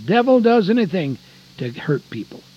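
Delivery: normal talking voice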